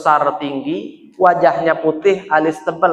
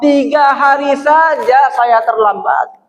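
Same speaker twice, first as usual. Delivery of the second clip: higher, louder anguished wail; weeping lament; 9 semitones higher, 4 dB louder than usual. Acted. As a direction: grieve for arriving too late. (ratapan sedih bernada tinggi)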